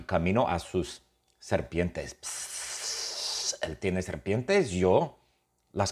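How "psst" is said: long, soft hiss; sustained sibilant noise; imitated snake hiss.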